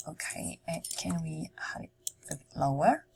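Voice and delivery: ordinary talk, nothing marked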